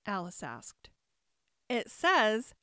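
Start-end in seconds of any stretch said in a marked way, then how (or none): 1.7-2.5 loud, eager daisy cry. A narrator's reading. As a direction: call it out proudly. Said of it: "cried a daisy".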